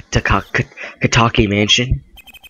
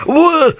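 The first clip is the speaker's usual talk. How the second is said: very high, loud whoa; startled yelp; 5 dB louder than usual. Acted. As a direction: yelp in mock alarm. Game hero startled by the lady.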